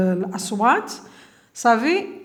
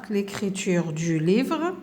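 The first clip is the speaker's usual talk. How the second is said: slow and softer; slow reading; 2 semitones lower, 5 dB quieter, much slower than usual. Shown as a demonstration. Teacher reading the heading to pupils, slowly and softly. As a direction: read the heading slowly, gently. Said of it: "عندنا نكتبوهم هنايا،".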